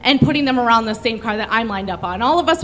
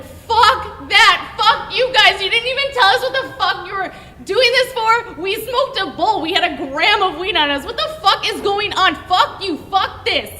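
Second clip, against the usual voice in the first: angry outburst; 7 semitones higher than usual. Retold or imitated. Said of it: "I'm like".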